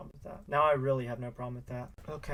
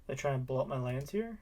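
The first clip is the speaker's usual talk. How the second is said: normal talking voice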